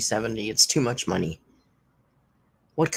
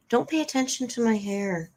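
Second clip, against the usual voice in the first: mocking Amber; 7 semitones higher, 3 dB quieter than usual. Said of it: "Just like she says".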